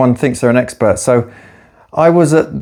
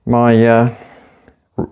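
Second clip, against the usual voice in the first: ordinary talk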